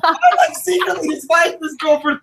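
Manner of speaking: high-pitched, shouted protest; outraged denial. Real, not acted. Local legend denying a rumor about a girl, outraged. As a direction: cry out in exasperated disbelief.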